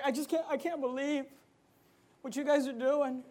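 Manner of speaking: soft mock-tearful protest; quiet, mildly upset churchgoer impression; teary-eyed imitation. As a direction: lightly imitate someone close to tears. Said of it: "she got all teary-eyed".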